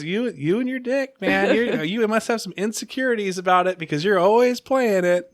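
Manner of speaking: retold exasperated teasing; Kristen. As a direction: imitate a woman needling a repeat offender. Imitating Kristen's rebuke.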